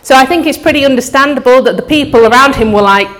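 ordinary talk, nothing marked

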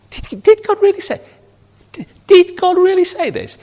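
high, mock-astonished serpent voice; feigned surprise. Shown as a demonstration. The serpent mocking Eve with feigned surprise. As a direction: act out a sneering, put-on astonishment. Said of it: "It's more a feigned expression of surprise".

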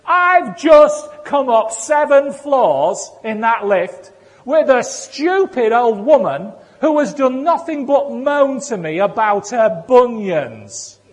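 acted angry rant, loud and high; exasperated outburst. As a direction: vent it slowly, hammering the words. Acting out the man's furious outburst.

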